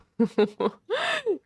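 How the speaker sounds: a high, bursting real laugh. Real, not acted. Viewer laughs at the story's cruel ending, real laugh. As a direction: laugh out at the bleak idea.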